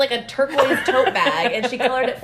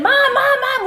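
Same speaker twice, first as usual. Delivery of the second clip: much higher, louder calling Mom; urgent calling; 12 semitones higher, 4 dB louder than usual. Retold or imitated. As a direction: yell for Mom like a tattling kid.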